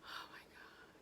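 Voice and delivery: voiceless, worn out from laughing; laughter-choked whisper. Real, not acted. Storyteller catching herself laughing, spent whisper. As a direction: whisper it while recovering from laughter.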